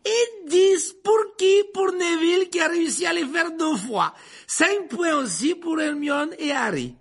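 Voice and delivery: high, loud, slow proclamation; jubilant praise. A narrator's reading. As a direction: announce points to a cheering class. Lupin congratulates the class over applause. (félicitations proclamées fort et aigu)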